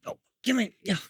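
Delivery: very high coaxing call. Real, not acted. Trainer coaxing the dog closer in high baby talk.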